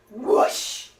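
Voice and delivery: breathy rushing-water imitation; whooshing water noise.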